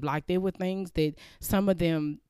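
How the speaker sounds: ordinary talk, nothing marked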